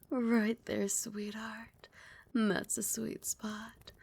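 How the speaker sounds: ordinary talk, nothing marked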